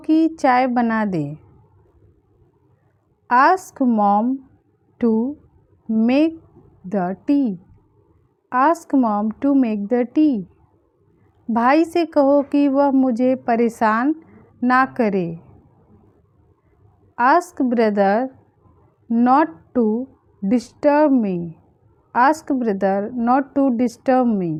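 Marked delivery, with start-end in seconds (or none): 3.3-7.6 long pauses around "to" and "make"; word by word. Shown as a demonstration. Teacher giving learners the English for a request, word by word. 17.2-21.4 pauses after "brother" and "not to"; feed it to learners in short chunks; phrase by phrase. Shown as a demonstration. Teacher translating a request for learners, phrase by phrase.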